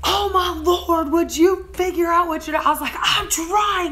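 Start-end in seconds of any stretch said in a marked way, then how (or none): 0.0-2.6 retold quarrel, pitched high; exasperated arguing. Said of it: "Like, we're fighting". 3.0-3.9 high, frustrated retold protest; pitched-up bickering comeback; defensive arguing. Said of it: "Like, we're fighting".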